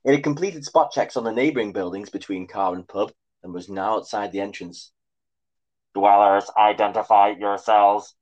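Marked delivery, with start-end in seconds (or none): 5.9-8.1 loud, slow robot command; demanding robot. A narrator's reading. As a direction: bark an order like a machine. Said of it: "it demanded".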